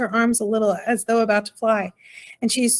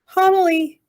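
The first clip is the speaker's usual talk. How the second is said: high urgent call down a hole; 9 semitones higher, 4 dB louder, slower than usual. A narrator's reading. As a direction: call her name out loud. Calling out into hole.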